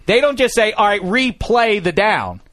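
loud, high-pitched incredulous rant; exasperated, near-shouting disbelief; flustered outrage. Real, not acted. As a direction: rant at full pitch, hammering out every phrase. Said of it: "I'm sorry, I'm flustered".

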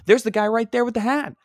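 retold delighted shout of recognition; excited calling out. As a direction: point him out to the whole group. Calling out on spotting the man.

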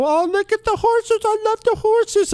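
high, gushing mock animal lover. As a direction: coo over the horses like an over-the-top animal lover. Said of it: "one of those people".

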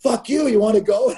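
high-pitched mock yell; strained, high imitation of yelling across the ice; mock challenge. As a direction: throw back a hammed-up dare to fight. Retold on-ice challenge to fight.

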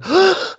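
high, sharp mock gasp; exaggerated intake of breath; mass gasp, imitated. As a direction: act out a planeful of passengers gasping. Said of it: "the whole plane starts going".

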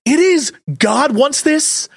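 normal talking voice

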